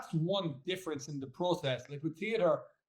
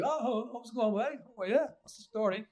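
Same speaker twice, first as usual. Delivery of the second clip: higher, thick Cork lilt; broad Cork accent; 8 semitones higher than usual. Retold or imitated. Actor showing how he talks once home in Cork, broad Cork accent. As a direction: slip straight into a broad Cork greeting. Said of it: "the minute I get to Cork, it's like".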